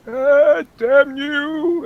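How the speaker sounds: pained high yell; shout. Acted. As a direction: cry out in pain. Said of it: "he's gonna say".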